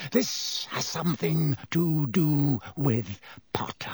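slow, hissed whisper; teacher whispering his suspicion, menacing. A narrator's reading. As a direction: breathe the accusation with menace.

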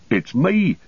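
high-pitched call on arrival; hailing cry, pitched well up; shout. A narrator's reading. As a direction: call out to be recognised from a way off. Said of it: "shouted Sam as he trotted up".

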